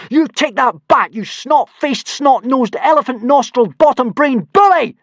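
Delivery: high, loud furious insults; yelling. Acted. Hiccup yelling insults at Snotlout, high and loud. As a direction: hurl every insult at the bully. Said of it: "yelled Hiccup".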